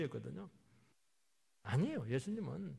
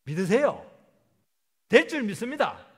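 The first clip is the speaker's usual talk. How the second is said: loud, high declaration of faith; fervent preaching; 9 semitones higher, 14 dB louder than usual. Real, not acted. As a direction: exhort the congregation at full voice. Preaching at full force.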